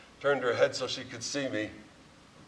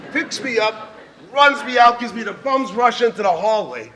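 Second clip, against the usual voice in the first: excited; 10 semitones higher, 11 dB louder than usual. Real, not acted. Excited retelling of being dragged.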